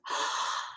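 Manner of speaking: sharp sudden gasp of realization; loud gasp. A narrator's reading. Girl realizing the fairy's secret, gasping. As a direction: gasp as it dawns. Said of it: "I did a loud gasp".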